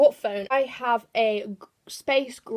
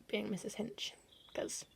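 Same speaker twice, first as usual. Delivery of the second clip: breathy voiceless whisper, much softer; whispered aside; 15 dB quieter than usual. Acted. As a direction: drop to a secret whisper. Aside about a cleaning influencer.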